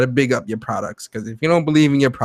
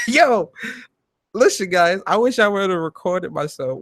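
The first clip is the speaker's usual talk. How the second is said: ordinary talk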